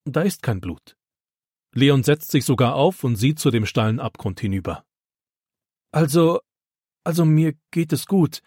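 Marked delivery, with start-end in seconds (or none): 5.9-8.4 shaken, halting repeated start; stammering. A narrator's reading. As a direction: stumble over the words in shock. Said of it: "stammelt er".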